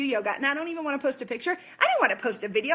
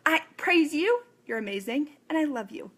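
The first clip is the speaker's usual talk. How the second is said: usual speaking voice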